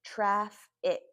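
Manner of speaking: "traffic" split as traf, ick; syllable by syllable. Shown as a demonstration. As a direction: say "traffic" in two slow, separate chunks. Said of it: "Put the two together".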